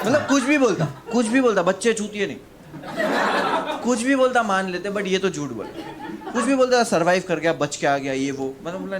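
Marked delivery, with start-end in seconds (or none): 0.1-6.8 high, loud exasperated repetition; laughing outrage; indignant rant. Real, not acted. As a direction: vent disbelief at the liar.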